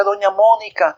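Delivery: high urgent call; calling from afar. A narrator's reading. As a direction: shout her name from down the road. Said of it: "gritando desde lejos decía".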